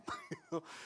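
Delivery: tiny high-pitched laugh; squeaky high laugh. Real, not acted. Preacher laughs at the cheesy song line, squeaky laugh.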